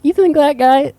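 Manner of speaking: put-on high-pitched doubting kid; hammed-up child's protest; imitated incredulous child. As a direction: question it in total disbelief. Child self incredulous at mom.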